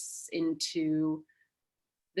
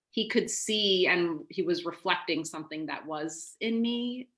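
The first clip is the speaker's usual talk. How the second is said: normal talking voice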